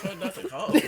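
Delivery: laughing while speaking; words breaking into laughter. Real, not acted. Laughing after cinnamon.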